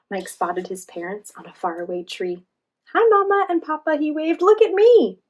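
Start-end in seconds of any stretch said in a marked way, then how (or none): 2.9-5.2 high, loud happy call; thrilled little monkey, much higher and louder; excited. A narrator's reading. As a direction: show off to your parents. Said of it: "In his excitement".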